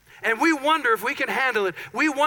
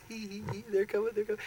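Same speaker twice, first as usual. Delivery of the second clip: hushed mock-sneaky giggling; sneaky snicker; 10 dB quieter, faster than usual. Trap setter lying in wait for the victim, sneaky hushed snicker. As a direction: snicker softly from a hiding place. Acting someone hiding to scare.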